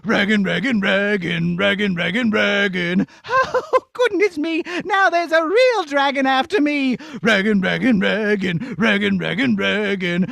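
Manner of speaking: low rhythmic dragon chant. Acted. Dragon chasing the princess, chanting. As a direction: stomp like a hungry dragon.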